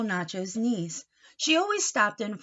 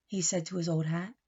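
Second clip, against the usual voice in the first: plain reading voice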